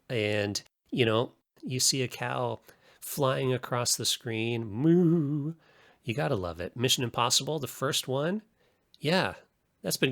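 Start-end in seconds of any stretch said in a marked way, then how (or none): 4.7-5.5 higher, louder mock cow moo; playful imitated cow lowing. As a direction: moo like a cartoon cow flying past. Cow flying across the screen.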